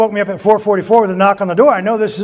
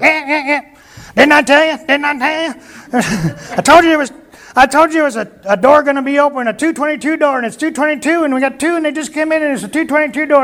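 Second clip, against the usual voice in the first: put-on high, excited old prophet insisting; imitating old prophet Bob; 8 semitones higher than usual. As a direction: play Bob as a high-voiced old man crowing that he was right. Bob's voice voiced in story.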